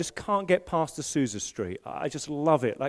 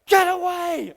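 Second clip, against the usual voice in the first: retold startled shout; 11 semitones higher, 4 dB louder, slower than usual. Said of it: "like, shouting in his face".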